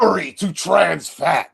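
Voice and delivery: slow, drawn-out, high chant; solemn proclamation. Acted. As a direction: proclaim it like a sacred motto. Echoes donor's message, slow and high.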